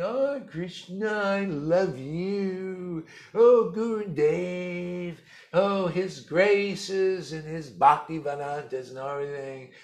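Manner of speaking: high, loud, drawn-out gushing devotee parody; mock pious devotee. Guru mocking devotees who only pretend the mood, high and loud. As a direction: ham up a showy devotee crying out to Krishna.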